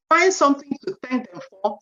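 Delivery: ordinary talk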